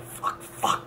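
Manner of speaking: whispered curses under the breath; panicked whisper. Retold or imitated. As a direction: hiss it to yourself in panic. Panicking as he's pulled over.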